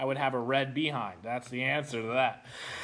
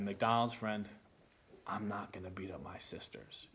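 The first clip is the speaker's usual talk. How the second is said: much softer, lower, near-whispered no; hushed, lowered refusal; quiet refusal; 7 semitones lower, 11 dB quieter than usual. Retold or imitated. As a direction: say it low and hushed, standing firm. Final refusal, retold.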